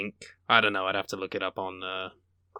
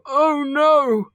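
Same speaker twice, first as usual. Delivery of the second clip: high, drawn-out mock dismay; hammed-up group horror, pitched way up; dismayed audience; 11 semitones higher than usual. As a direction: wail it like a scandalised crowd. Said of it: "purists in the audience go".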